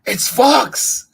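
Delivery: high, loud squeaky announcement; piercing little mouse voice; excited mouse. Acted. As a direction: squeal out who it is. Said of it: "said the mouse".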